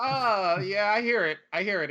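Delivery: airy pretend agreement; mock knowing reassurance. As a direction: play along like you hear it too. Mock reaction to squeaky car.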